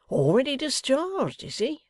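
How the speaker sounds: high surprised question; taken aback, asking back. A narrator's reading. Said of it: "returned Wegg, surprised".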